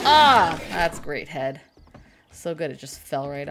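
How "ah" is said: sudden loud yelp of pain. Real, not acted. A yelp as the zombie strikes. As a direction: cry out as the hit lands.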